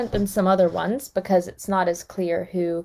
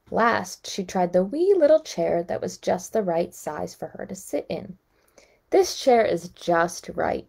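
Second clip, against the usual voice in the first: calm storybook reading voice; story narration. A narrator's reading. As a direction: read it like a bedtime story. Reading fairy tale aloud.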